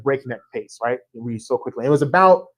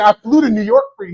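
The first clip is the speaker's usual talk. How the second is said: indignant plea; 8 semitones higher, 7 dB louder, faster than usual. Retold or imitated. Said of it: "I was like".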